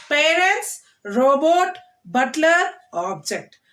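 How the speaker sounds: each word hit on its first syllable, Parents, robot, butler, object; first-syllable stress. Shown as a demonstration. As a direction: model the stress on the front of each noun. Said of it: "noun, stress the first syllable".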